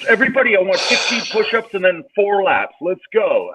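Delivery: hammed-up gym coach calling out drills; bossy coach voice. Penis as coach ordering everybody around, high mock bark. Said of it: "he'd be like".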